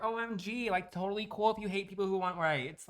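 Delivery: high, mocking sugary voice; mock a fake-nice streamer; fake sweet and innocent. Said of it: "act all sweet and innocent".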